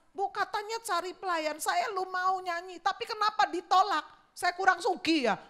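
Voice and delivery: high, pleading complaint voice; a hint of a hurt hopeful singer; whiny eager singer. Rejected singer whining to the worship leader, high and pleading.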